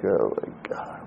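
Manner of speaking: a weary whispered oh my God, barely voiced; exasperated whisper. Listener whispering to themselves while someone yells at them. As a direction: breathe it out unvoiced under your breath.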